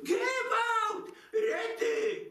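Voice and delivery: a high, shrill-pitched cry of "gewalt" from a fainting mother; comic scream. Acted. Mother crying for help over her swooning daughter, a high comic shriek. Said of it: "krzyknęłam".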